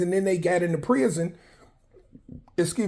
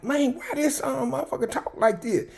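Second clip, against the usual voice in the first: high, puzzled inmate voice, a bit softer; mock the question again, pitched up; imitating an inmate; 8 semitones higher, 3 dB quieter than usual. Inmates wondering why she talks proper, high and puzzled. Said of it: "And they was like".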